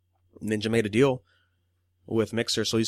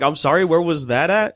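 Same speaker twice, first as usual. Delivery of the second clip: higher and louder, in baffled disbelief; mock bewilderment; 6 semitones higher, 7 dB louder than usual. Mocking Dante's sudden cursing, high and louder in bafflement. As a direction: ask it like you can't believe what you heard. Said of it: "It's like".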